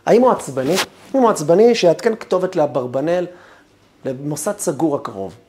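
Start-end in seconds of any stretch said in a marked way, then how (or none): none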